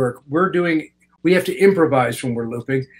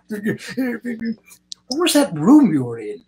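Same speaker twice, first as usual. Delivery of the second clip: higher, slow, wide-eyed imitation of a fascinated star; curious Anthony Hopkins; 8 semitones higher, slower than usual. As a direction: play him amazed and curious, drawing the words out. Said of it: "He goes".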